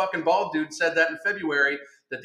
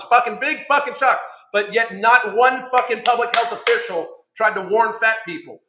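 angry rant; 4 semitones higher, 5 dB louder than usual. Real, not acted. Ranting about health officials.

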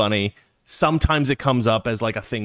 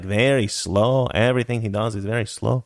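sluggish Mexican mouse voice; Slowpoke Rodriguez; 2 semitones lower than usual. Acted. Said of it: "you have, like, Slowpoke Rodriguez".

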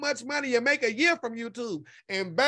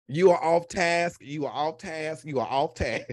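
mock teacher scolding; 6 semitones lower than usual. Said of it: "repeats self-scolding slowly".

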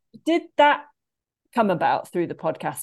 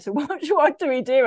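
laughing bewilderment; 8 semitones higher, 4 dB louder than usual. Retold or imitated. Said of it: "It's like".

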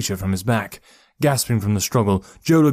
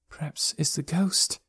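hushed, high, wondering thought; small soft voice of worry; thinking to himself; 6 semitones higher, 6 dB quieter than usual. A narrator's reading. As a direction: think it softly to yourself, pitched high. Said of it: "he thought".

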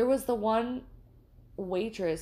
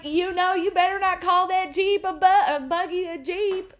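much higher, louder mock warning; waitress warning again; 10 semitones higher, 7 dB louder than usual. Waitress warning about the buggy, high mock voice. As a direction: scold like a backroads waitress. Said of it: "she was like".

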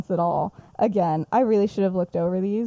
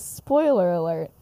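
ordinary delivery

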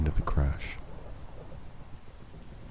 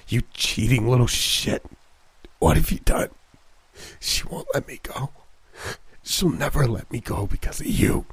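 strained, hissing, higher accusation; angry hiss; 7 semitones higher than usual. Acted. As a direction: spit the blame through broken teeth. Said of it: "His grimace twisted with anger".

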